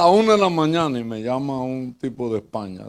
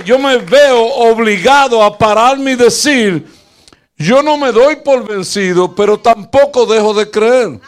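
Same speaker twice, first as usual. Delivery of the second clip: louder, higher fervent preaching; preaching at full force; 7 semitones higher, 9 dB louder than usual. Acted. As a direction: build to a rousing sermon climax. Sermon climax, building to full force.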